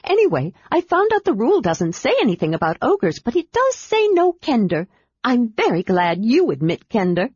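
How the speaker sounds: high, eager, chattering kender voice; bubbly little adventurer who can't stop talking; excited kender. Acted. Kender Tass explains eagerly.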